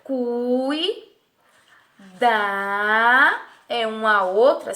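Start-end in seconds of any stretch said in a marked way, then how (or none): 0.0-3.4 "cui" and "da" each drawn out, a pause between; separated syllables. Shown as a demonstration. Teacher separating syllables for pupils, "cui" and "da" each held alone. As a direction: hold "cui", stop, hold "da". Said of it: "isso aqui tudo corresponde a uma sílaba".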